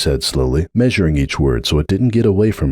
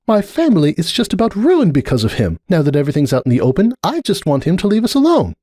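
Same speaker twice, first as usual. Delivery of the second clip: higher voice, growing hard and defensive; a lightly voiced mare digging in her heels; Cupcake; 10 semitones higher than usual. A narrator's reading. Cupcake refusing to hear about Sunburst, defensive.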